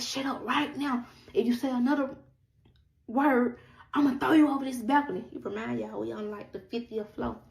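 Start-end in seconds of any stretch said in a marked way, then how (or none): 0.0-5.3 retold rage, pitch up, volume growing to the end; angry threat. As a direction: imitate his furious threat, high-pitched. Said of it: "because he's so angry".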